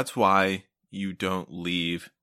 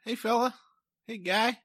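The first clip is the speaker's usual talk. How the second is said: mock polite call; 11 semitones higher, 4 dB quieter than usual.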